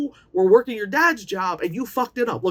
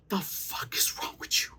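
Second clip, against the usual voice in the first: whisper; 10 dB quieter than usual. Retold or imitated. Shocked reaction to the girl.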